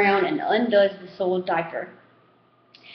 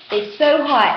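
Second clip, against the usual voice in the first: plain reading voice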